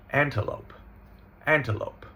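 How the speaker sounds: "antelope" said AN-tuh-lope; schwa in middle syllable. Shown as a demonstration. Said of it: "we're gonna schwa it".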